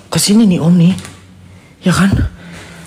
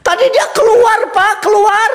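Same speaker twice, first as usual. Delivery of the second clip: much higher, urgent; alarmed insistence; slower than usual. Real, not acted. Spooked host tells the caretaker it came out, high alarmed.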